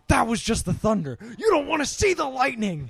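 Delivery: very loud, high-pitched threat; angry teacher shout. Retold or imitated. Latin teacher silencing a talking class, furious imitated shout. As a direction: thunder it at the whole class. Teacher scolding after smacking ruler.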